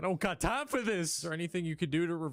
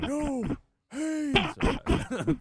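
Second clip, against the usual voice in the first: excited shout; 12 semitones higher, 6 dB louder, much slower than usual. Real, not acted. Laughing at failed call.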